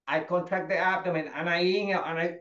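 ordinary delivery

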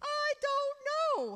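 put-on high, drawn-out teenage wail; exaggerated high whine through pretend tears; mock crying wail. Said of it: "Well, why are you crying?".

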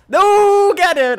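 high, loud drawn-out cry; urgent yell. Real, not acted. Player calling to Arnold mid-play, urgent yell. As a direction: yell high and long in alarm.

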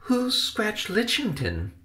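very high, light, curious voice; high-voiced puzzled nephew; child-like questioner. Benny questions his uncle.

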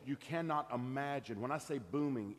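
usual speaking voice

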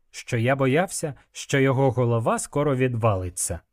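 usual speaking voice

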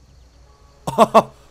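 laugh. Real, not acted. Surprised at harvest option.

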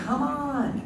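mock yell. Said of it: "my brother was yelling".

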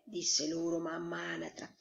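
ordinary delivery

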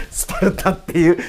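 words through real laughter; amused, laugh breaking into the speech. Real, not acted. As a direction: get the word out between laughs. Laughing while finishing the sentence.

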